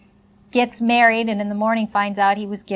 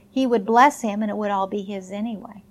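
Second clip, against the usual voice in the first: normal talking voice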